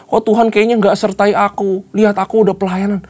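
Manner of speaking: high-pitched mock outcry to God; mock crying out. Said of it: "sudah teriak-teriak".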